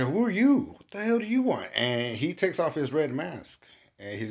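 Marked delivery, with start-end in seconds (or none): 0.0-1.7 high, louder indignant demand; angry confrontation. Retold or imitated. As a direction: challenge an intruder, voice jumping up high. Confronting the demon in retold scene.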